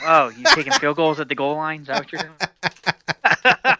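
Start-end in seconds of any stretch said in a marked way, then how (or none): 1.8-3.8 laughing tease. Real, not acted. Teasing cohost, laughing.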